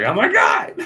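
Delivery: very high, loud mock scream; mock freak-out. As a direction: shriek it in exaggerated panic. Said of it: "he's, like, freaking out too".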